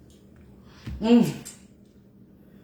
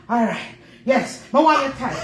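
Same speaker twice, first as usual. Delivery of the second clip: ordinary talk, nothing marked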